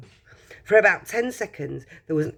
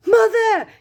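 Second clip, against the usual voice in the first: put-on one high, loud boyish yell; excited yell; 8 dB louder than usual. A narrator's reading. Charlie rushing in on his mother, excited yell. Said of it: "yelled Charlie".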